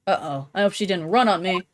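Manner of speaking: normal talking voice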